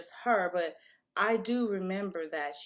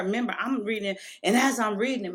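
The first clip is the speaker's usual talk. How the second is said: ordinary talk, nothing marked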